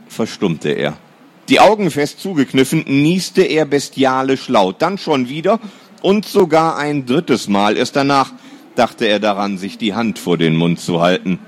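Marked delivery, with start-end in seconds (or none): none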